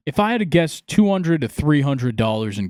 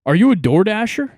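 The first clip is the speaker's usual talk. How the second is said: 模仿陌生人，高声大声地问，尾音上扬; 提高音调放大声，像在停车场隔着车位喊话; mock stranger's question; 7 semitones higher, 6 dB louder than usual. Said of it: "And he goes".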